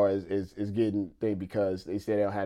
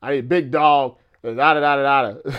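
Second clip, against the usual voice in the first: mock macho declaration, louder and raised; single man bragging; 3 semitones higher, 7 dB louder than usual. Married streamer imitates single guys wanting big dogs, mock bragging. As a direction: lightly play a boastful bachelor. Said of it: "when you're single, you're like".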